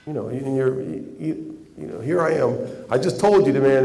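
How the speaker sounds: ordinary talk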